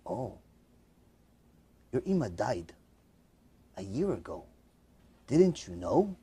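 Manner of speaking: very soft, low, halting; grave news. Retold or imitated. Old woman telling son his mother died, hushed and grave. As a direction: break it gently in a low hush with long pauses.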